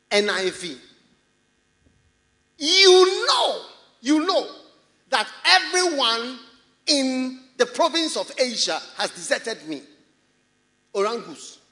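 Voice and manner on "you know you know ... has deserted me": loud, high opening easing down into the verse; preach. Acted. Preacher reading Paul's words to the church, loud preaching. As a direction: declaim the scripture at full force.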